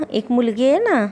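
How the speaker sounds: ordinary talk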